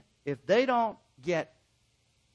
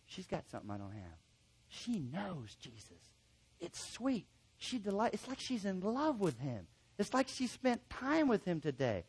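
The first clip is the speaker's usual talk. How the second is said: soft admiring hush; 2 semitones higher, 7 dB quieter than usual. Pretend. Counselee admiring the counselor's faith.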